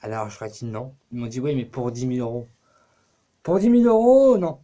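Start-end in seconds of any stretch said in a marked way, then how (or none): 3.4-4.6 high, loud incredulous repeat; indignant, swooping high exclamation; mock outrage. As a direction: shout it back in exaggerated disbelief. Outraged reply to the offer.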